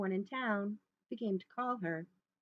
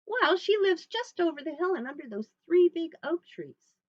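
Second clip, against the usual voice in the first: put-on higher, louder girl's voice; innocent little girl; 8 semitones higher, 8 dB louder than usual. Little Red gives the wolf directions, high and innocent. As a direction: answer openly, pitched up.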